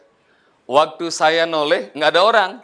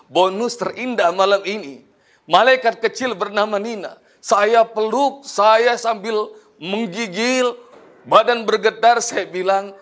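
normal talking voice

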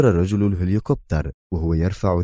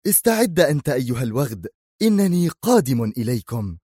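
higher, firm battle cry at the enemy; firm stern shout; 7 semitones higher than usual. A narrator's reading. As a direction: call the threat out sternly, pitched up. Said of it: "صرخ في حزم وصرامة".

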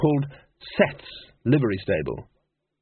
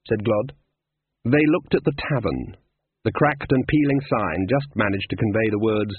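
usual speaking voice

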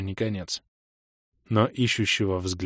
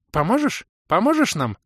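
put-on old creature voice; 10 semitones higher than usual. A narrator's reading.

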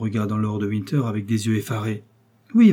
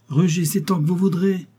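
high mocking exclamation; exclaiming; 7 semitones higher than usual. A narrator's reading. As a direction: taunt her, raising the voice. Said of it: "s'écria lord de Winter". (exclamation railleuse)

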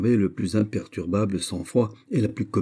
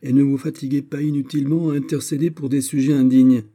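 much higher, loud angry order; furious command; 8 semitones higher, 6 dB louder than usual. Acted. Mother silences her daughter, high and furious. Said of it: "Sa colère était trop grande".

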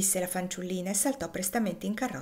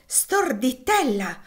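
louder, higher, drawn-out scolding; exasperated mother's rebuke; impatient cry; 5 semitones higher, 5 dB louder, much slower than usual. A narrator's reading. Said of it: "Gridò Maria battendo i piedi con impazienza".

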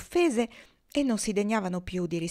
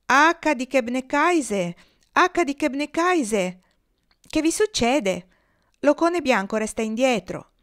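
loud, slow urgent call; 5 semitones higher, 5 dB louder, slower than usual. A narrator's reading. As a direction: call up to the leader. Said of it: "chiamarono l'oca che volava in testa".

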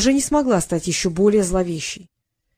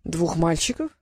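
lower, softer sceptical "Двух мальчиков?"; wincing doubt; 4 semitones lower, 5 dB quieter than usual. A narrator's reading. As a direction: repeat it with a disdainful wince. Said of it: "вошедший поморщился".

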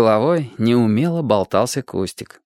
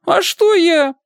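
much higher, louder cheeky protest; mock innocent boy; 5 dB louder, slower than usual. A narrator's reading. Boy caught out protests his innocence, high and cheeky. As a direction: play dumb like a caught kid.

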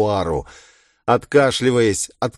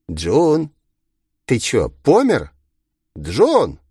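higher, swooping calls down the phone; calling out mockingly; 5 semitones higher, 4 dB louder, much slower than usual. A narrator's reading. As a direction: prod the silent listener with teasing impatience. Villain calls to silent inspector.

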